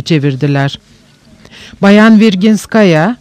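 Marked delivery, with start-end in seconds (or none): none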